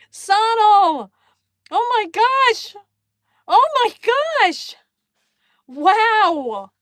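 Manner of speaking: loud, high, overjoyed exclamations; ecstatic shouting. Real, not acted. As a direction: shout with pure delight at the gift. Reacting to surprise gift.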